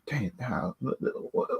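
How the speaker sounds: ordinary talk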